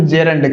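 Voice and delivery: "gerund" said as JEH-rund, e first; correct gerund pronunciation. Shown as a demonstration. Teacher correcting students' pronunciation, gerund said properly as JEH-rund. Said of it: "इसका correct pronunciation क्या होता है".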